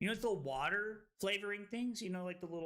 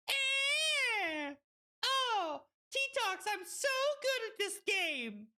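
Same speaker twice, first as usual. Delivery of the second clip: excited squeal; 14 semitones higher, slower than usual. Real, not acted. Excited at a game win.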